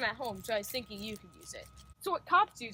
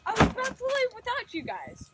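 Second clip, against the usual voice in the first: mocking; 7 semitones higher, 5 dB louder than usual. Bully greets mockingly.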